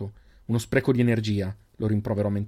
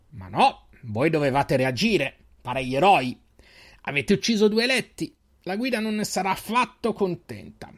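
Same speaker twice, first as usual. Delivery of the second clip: high mocking menacing voice; sneering villain; 5 semitones higher than usual. Extris leader taunting the captured group, high sneering voice. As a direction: taunt the cornered heroes.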